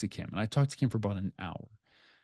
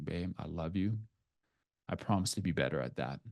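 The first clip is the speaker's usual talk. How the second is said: softer and lower, gently reassuring; hushed, loving vow to his wife; tender promise; 5 semitones lower, 9 dB quieter than usual. Retold or imitated. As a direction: promise it quietly and sincerely. Reassuring his wife over FaceTime.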